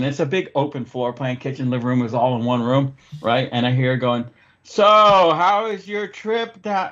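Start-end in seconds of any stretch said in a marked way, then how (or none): 4.6-6.9 loud, high, drawn-out question for deaf ears; imitated slow yelling. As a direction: yell it slowly, as if to someone nearly deaf. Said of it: "I'm fucking yelling".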